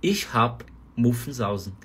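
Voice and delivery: slow, careful "Ich hab Muffensausen"; slow phrase demo. Shown as a demonstration. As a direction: model the sentence slowly for learners. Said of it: "Sílaba tônica".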